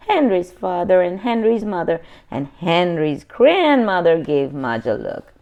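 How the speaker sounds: plain reading voice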